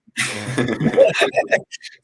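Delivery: a loud burst of real laughter; hearty laugh. Real, not acted. Laughs at Andy Carroll joke.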